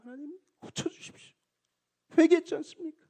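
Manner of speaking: tearful plea. Retold or imitated. Pastor's earnest healing prayer.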